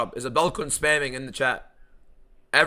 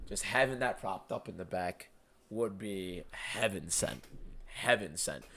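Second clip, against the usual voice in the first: dreamy hush; 7 semitones lower, 14 dB quieter than usual. Real, not acted. Dreaming of the play button.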